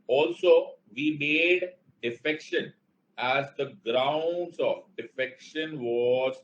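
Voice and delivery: words stretched slowly so students can write; slow dictation. Shown as a demonstration. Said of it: "teacher dictates slowly for notes".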